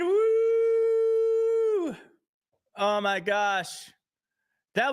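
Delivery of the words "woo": excited whoop. Real, not acted. Host cheering after the guest leaves, whooping. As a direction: cheer with delight.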